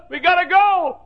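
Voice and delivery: shouted high and loud, pleading; urgent shout. Retold or imitated. Preacher shouting a retold plea.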